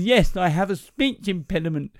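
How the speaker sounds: comic man with impeded speech; speech impediment. Acted. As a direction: garble it like someone who cannot articulate. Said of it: "you have a speech impediment".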